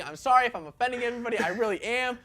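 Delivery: usual speaking voice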